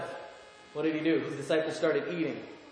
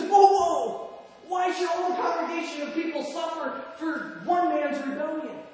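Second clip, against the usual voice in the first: higher, urgent plea to stop; alarmed protest; 10 semitones higher than usual. Acted. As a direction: cry out to hold back the judgment. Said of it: "And Moses says".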